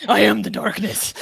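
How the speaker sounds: put-on dark superhero voice, loud; hammed-up brooding vigilante growl through laughter; Batman. Co-host joking about the woman's manly voice, mock superhero. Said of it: "She's Batman".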